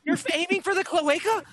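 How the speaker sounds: high, loud disbelieving question; incredulous outburst. Real, not acted. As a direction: blurt it out in amused disbelief. Game master reacts to absurd target.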